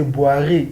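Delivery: "boire" as bwa-RAY, final e sounded; wrong French reading, "boire" in two syllables; mispronunciation demo. Shown as a demonstration. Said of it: "we cannot say boire".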